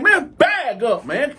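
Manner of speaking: high, stretched-out warning; retold angry warning. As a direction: push them off with your voice. Retold pushing the men away.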